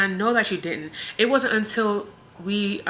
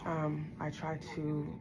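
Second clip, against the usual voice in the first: much softer, worn out voice; quiet, holding back tears; emotional, subdued; 2 semitones lower, 15 dB quieter than usual. Real, not acted. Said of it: "I'm getting emotional again".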